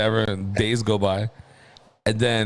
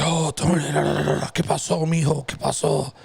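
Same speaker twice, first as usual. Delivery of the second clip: higher-pitched imitation of a worried parent; alarmed dad; 5 semitones higher than usual. Dad imitated finding his son bloodied, mock alarm. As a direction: babble in mock panic, switching into Spanish. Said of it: "he thinks I cut my stomach open".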